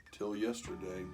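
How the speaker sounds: usual speaking voice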